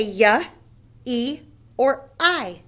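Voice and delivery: "y", "E", "I" as three separate sounds, pausing between; letter sounds. Shown as a demonstration. Teacher showing what Y can say, "y, E, or I" as separate sounds. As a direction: sound out "y", then "E", then "I". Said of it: "So Y can say".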